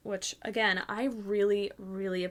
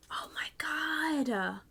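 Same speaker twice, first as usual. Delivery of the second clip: slow, breathy whispered amazement; stunned awe; 6 semitones higher, 6 dB quieter, much slower than usual. Real, not acted. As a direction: draw it out softly, overwhelmed. Stunned by the gift.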